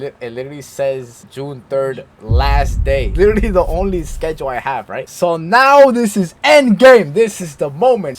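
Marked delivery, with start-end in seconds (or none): 5.1-8.2 loud, high and drawn-out buildup; dramatic announcement. Real, not acted. Storyteller hyping the last day at work, dramatic announcement. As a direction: announce it like the big climax.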